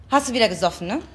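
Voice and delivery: usual speaking voice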